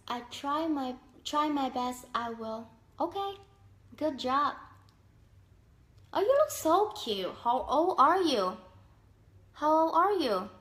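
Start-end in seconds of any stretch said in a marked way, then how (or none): 6.1-10.5 high, loud excited compliment and question; excited gushing. Real, not acted. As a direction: gush in real surprise, voice up. Gushing over a young-looking caller.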